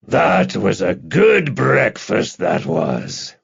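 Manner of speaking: slow, drawn-out big man's relish; satisfied hulk. A narrator's reading. Big Podine praises his breakfast, slow and satisfied.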